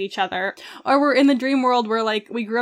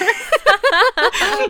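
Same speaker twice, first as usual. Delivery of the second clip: high laugh; 11 semitones higher, 6 dB louder than usual. Real, not acted. Laughs at her own note.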